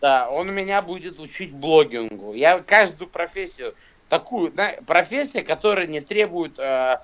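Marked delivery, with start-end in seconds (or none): none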